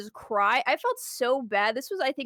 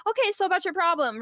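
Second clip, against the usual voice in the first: high, put-on customer-service voice; falsely composed, pitched up; 7 semitones higher than usual. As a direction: act politely back on the call. Retold return to customer on phone.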